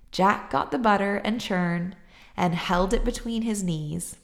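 usual speaking voice

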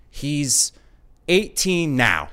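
mock-sheepish driver, slow; awkward admission. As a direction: hold the pause, then drop the line slowly. Driver's awkward reveal after a pause.

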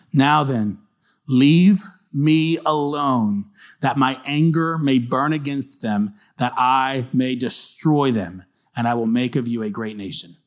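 slow, drawn-out words with heavy emphasis; solemn scripture reading. A narrator's reading. God telling Moses to leave him alone, slow and emphatic. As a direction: stretch each word of God's warning. Reads God's angry words.

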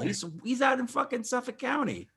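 high-pitched exasperated rant; incredulous insistence. Real, not acted. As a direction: keep insisting, high and disbelieving. Escalating incredulous rant about accent.

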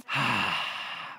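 deep low blissful sigh; contented dog sigh. Retold or imitated. Jack Russell lying by the heater, low blissful sigh. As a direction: sigh like a cozy old dog. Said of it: "that Jack Russell was like".

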